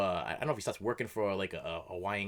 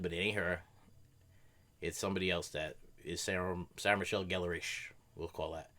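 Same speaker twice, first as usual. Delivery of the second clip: normal talking voice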